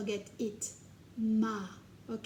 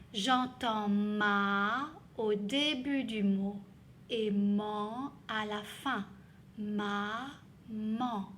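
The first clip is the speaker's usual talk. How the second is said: slow explanation; slower than usual. Shown as a demonstration. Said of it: "teacher explains syllables".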